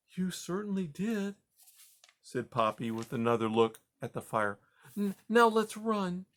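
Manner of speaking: puzzled but kind; gentle grandma mouse. Acted. Grandma Poppy.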